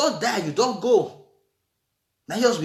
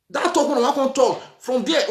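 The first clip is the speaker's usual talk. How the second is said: normal talking voice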